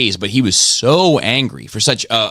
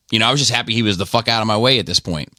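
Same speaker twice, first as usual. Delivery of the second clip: usual speaking voice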